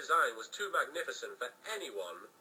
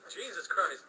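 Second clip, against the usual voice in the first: plain reading voice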